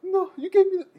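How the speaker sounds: scared student pleading with inmate; panicked kid. Retold or imitated. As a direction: beg like a terrified teen.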